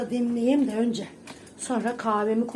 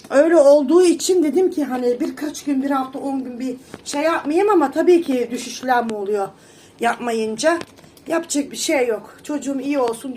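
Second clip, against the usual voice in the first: much louder, almost shouting to viewers; loud live talk; 8 dB louder than usual. Real, not acted. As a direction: push the voice out like on a live stream. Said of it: "bağırarak bağırarak konuşuyorum canlıda". (canlı yayındaki gibi bağırarak)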